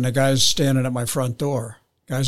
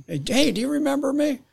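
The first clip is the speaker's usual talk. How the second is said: put-on high, eager voice of an old arrestee; eager stranger voice; 12 semitones higher than usual. Former arrestee greeting the cop, eager and high. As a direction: perk up like a stranger who knows you.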